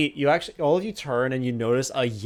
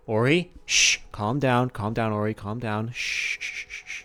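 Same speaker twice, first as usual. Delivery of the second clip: soothing hush; 3 semitones lower than usual. Acted. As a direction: shush her gently like a frightened friend. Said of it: "She's like".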